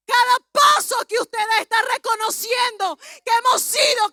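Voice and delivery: shouted, high-pitched sermon climax; loud, fervent proclamation in long driving phrases; full-force preaching. Real, not acted. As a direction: cry it out to rouse the congregation.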